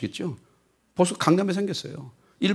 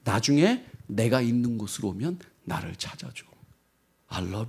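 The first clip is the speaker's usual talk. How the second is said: very soft, slow whispered farewell; a hushed parting promise, slowly; tender last words; 5 semitones lower, 7 dB quieter, slower than usual. Retold or imitated. Samantha's final soft words to Tim before leaving. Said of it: "마지막으로 뭐라 하냐면".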